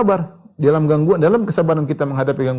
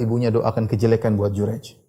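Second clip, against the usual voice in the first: ordinary talk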